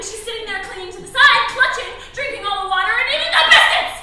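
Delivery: loud, high, mounting complaint; heated accusation. Pretend. Woman accusing mother of hogging supplies, indignant. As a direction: pile up the charges indignantly.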